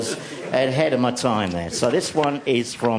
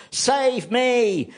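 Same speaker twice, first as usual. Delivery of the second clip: call for help; 12 semitones higher than usual. Acted. Voice calling in story.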